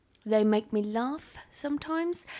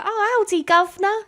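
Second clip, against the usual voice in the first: high, loud mock cockney of an American friend; high, loud parody of a bad British accent; 10 semitones higher, 6 dB louder than usual. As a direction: ham up a clumsy Yank doing posh British. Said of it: "they'll be talking back to me like".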